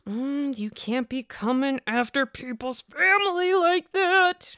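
slow, drawn-out exaggerated scolding; hammed-up imitation, stretching the words out; mock Wendy. Wendy objecting over family, slow exaggerated mock voice. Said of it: "She didn't say it like that".

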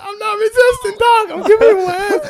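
high, loud yelp of innocence; retold panicked objection; frantic protest. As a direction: shout back while pinned by police. Retold protest while being arrested.

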